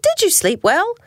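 high, lilting question; cheery wife bear. A narrator's reading. Missus Bear asking her husband on waking, cheery. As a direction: ask innocently in a high voice.

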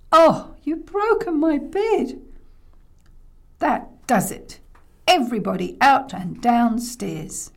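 loud, slow, emphatic scolding; angry grandma. Acted. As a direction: order everyone out, thoroughly fed up. Grandma scolds all after crash.

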